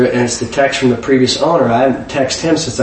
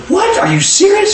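much higher, squeaky "What? Are you serious?"; stunned disbelief. Retold or imitated. The buddy, shocked by the dentist's news, high disbelief. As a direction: gasp it out in disbelief.

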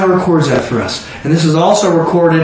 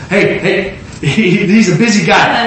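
ordinary talk

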